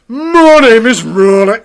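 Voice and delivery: loud, high, stretched-out Southampton accent; mock Southampton accent. Shown as a demonstration. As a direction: show off an exaggerated Southampton accent, slowly. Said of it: "this is the Southampton accent".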